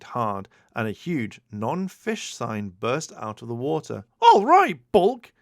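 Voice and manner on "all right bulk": much higher, louder cheering whoop; yell. A narrator's reading. Skull cheering Bulk's catch across the lake, yelling. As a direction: cheer your buddy on at full lung. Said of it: "Skull yelled".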